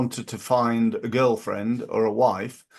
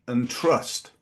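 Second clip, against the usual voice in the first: ordinary talk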